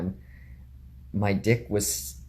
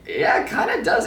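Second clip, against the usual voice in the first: smug; 13 semitones higher, 4 dB louder than usual. Retold or imitated. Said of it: "And I'm like".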